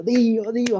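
high-pitched mock crowd chant; imitating adoring fans. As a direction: call out like tearful, cheering fans. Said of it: "la gente estaba llorando y aplaudiendo". (imitando el clamor agudo de los fans)

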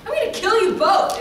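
loud, high mock threat. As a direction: imitate an angry fan raising her voice. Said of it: "she's raising her voice".

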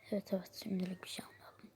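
soft, hurried echo; quiet agreement. Acted. Companion echoing the answer given to the waiter, quiet quick agreement.

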